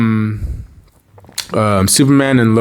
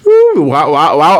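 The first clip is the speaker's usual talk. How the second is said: ooh wow reaction; 5 semitones higher, 5 dB louder than usual. Real, not acted. Reacting to the kiss.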